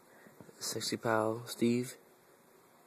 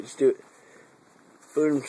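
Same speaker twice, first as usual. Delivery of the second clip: loud sharp urging; sudden loud; 4 semitones higher, 9 dB louder, much faster than usual. Acted. Urging the cat Steve with a sudden loud push. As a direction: snap it out loud.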